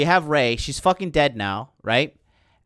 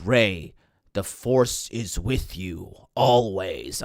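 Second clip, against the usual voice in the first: lower, slow mock-solemn wise voice; cheesy Luke; 7 semitones lower, slower than usual. Luke speaks to Rey from beyond, mock-solemn and slow. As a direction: intone it like a cheesy old mentor. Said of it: "Luke, he's like".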